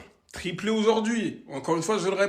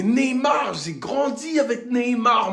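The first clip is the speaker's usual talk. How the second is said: passionate outburst; 5 semitones higher, 4 dB louder, slower than usual. Real, not acted. Passionate fan declaration.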